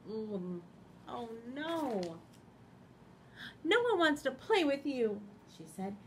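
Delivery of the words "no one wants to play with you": loud, high scolding lamb; loud Lambkin. Acted. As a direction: tell him off firmly.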